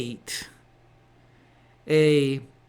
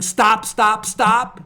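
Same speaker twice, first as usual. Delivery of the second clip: loud, raised, repeated order to halt; higher, forceful warning call; urgent command; 6 semitones higher, 9 dB louder, slower than usual. Acted. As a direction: call out like an agent halting a car. Agent in front signaling a driver.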